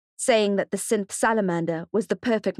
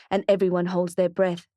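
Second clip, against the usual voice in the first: usual speaking voice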